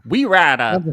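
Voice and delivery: Muppet-like Minnesota voice; Minnesota accent. Retold or imitated. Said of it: "That sounds like a Muppet".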